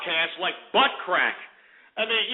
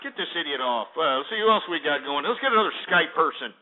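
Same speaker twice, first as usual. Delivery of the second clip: normal talking voice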